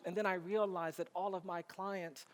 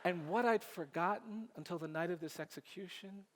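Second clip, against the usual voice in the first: ordinary talk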